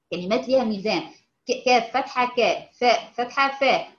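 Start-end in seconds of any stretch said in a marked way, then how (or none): none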